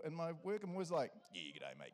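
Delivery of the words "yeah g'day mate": much lower, gruff Kiwi tradie greeting; low mock-macho hello to the builders; cool tough guy voice. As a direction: play it cool and hard like a tough bloke. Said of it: "my cool, tough guy voice".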